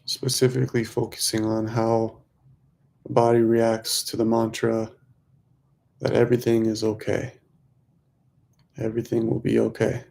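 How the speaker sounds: measured mantra pacing, leaving room to breathe; guided meditation. Real, not acted. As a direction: lead them gently through the breath, stretching key words.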